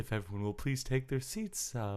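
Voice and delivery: soft, hushed arena announcer; quiet announcer. Pretend. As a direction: murmur the welcome politely. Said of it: "calmer, quieter start to the year".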